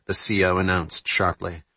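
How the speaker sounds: ordinary delivery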